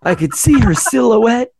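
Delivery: high voice, laughing through it; creepy mock voice. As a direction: play the giggling creep. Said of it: "Yeah, like".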